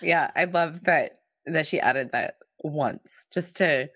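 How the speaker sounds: usual speaking voice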